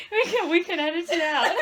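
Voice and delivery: loud, high, laughing through the words; can barely talk for laughing; laughing loudly. Real, not acted. Laughing hard about the door.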